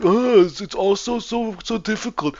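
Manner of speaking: high, moaning imitation of a complainer; mock whining. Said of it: "they start whining".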